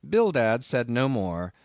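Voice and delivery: ordinary delivery, nothing marked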